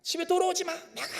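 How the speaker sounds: high, hammed-up scolding father; mock angry father. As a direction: play the furious dad throwing him out. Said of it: "아버지께서 뭐라고 했습니까?". (화난 아버지 흉내)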